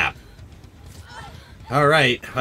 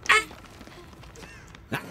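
unvoiced gasping scream; gasp-shriek in panic; breathy shriek of fright; 9 dB quieter than usual. Real, not acted. Gamer caught off guard, breathy panicked shriek.

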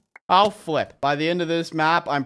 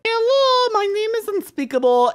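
very high, squeaky-pitched greeting; mock introduction; 5 dB louder than usual. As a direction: loudly introduce yourself in a silly high voice. Mock introduction addressed to the blocks.

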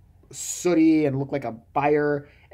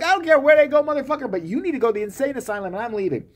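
mock angry retort; 8 semitones higher, faster than usual. Speaker's imagined retort, heated.

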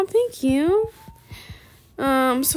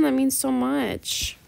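usual speaking voice

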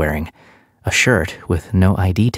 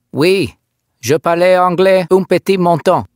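put-on high, thick French-accented voice; 12 semitones higher, 4 dB louder than usual. French officer answering the captive, thick French accent. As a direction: speak it like a native Frenchman. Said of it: "The accent was thick".

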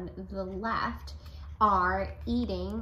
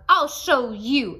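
grouchy threat; 4 semitones higher, 12 dB louder, slower than usual. A narrator's reading.

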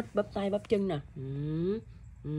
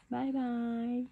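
soft, high, airy bye-bye; hushed goodbye; 4 semitones higher, 5 dB quieter than usual. Real, not acted. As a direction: let the long farewell fade toward a whisper. Last goodbye, fading to softness.

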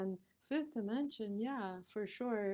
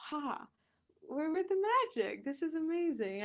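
higher, fast, giddy delight; thrilled; 8 semitones higher, 4 dB louder than usual. Retold or imitated. Finding glowing coins by the river, thrilled and higher. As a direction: squeal it like finding real magic. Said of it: "I was like".

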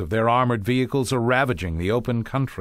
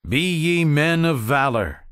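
slow, loud, high declaimed title; grand title read; 6 semitones higher, 5 dB louder than usual. Acted. Teacher starting the Churchill reading, slow and grand. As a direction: announce it like an orator. Reads the speech title aloud.

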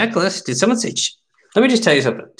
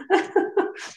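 high, breathy giggle; amused laugh; 5 dB quieter than usual. Real, not acted. Card opener tickled by old packaged gum, giggling. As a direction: giggle helplessly, mostly air.

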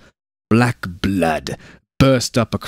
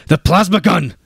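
much higher, louder repeated yell; 11 semitones higher, 6 dB louder than usual. A narrator's reading. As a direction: push it harder the second time. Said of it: "he yelled".

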